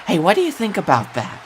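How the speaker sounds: normal talking voice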